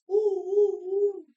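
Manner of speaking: put-on loud, high baby coos; imitating baby cooing. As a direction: coo like the baby trying to say love. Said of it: "and then he'll go".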